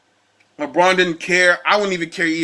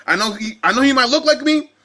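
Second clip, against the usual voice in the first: usual speaking voice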